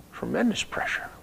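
dramatic whisper. Acted. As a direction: hush it out, letting Tremendous rise. Preacher whispers for emphasis.

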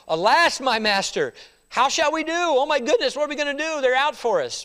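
high, loud alarm; panicked servant. Acted. Servant crying to Elisha about the army, panicked. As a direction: cry out in panic at the surrounding army. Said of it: "And his servant said unto him".

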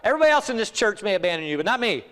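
high, loud, over-eager pledge; acted loud boast to the Lord; Peter boasting. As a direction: proclaim loyalty with bold self-assurance. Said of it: "We're like Peter".

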